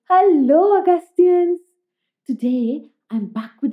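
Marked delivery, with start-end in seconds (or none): 0.1-1.6 high, loud hello; excited greeting. Real, not acted. Host greeting children at story time, loud and high. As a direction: call out a big cheery hello.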